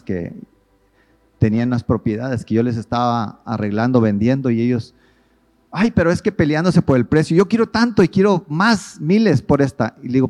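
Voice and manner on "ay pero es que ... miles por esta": high, loud whiny haggling; mock the grasping sellers; shrill-free, high loud mimicry of demanding clients; greedy clients.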